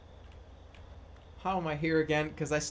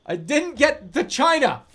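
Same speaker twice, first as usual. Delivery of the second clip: exasperated; 9 semitones higher, 10 dB louder than usual. Real, not acted. Failing the heist, frustrated.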